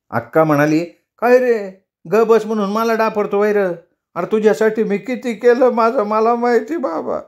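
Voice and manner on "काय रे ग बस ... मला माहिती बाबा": much higher, hurt and indignant sister; offended sister. A narrator's reading. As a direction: play the wounded elder sister.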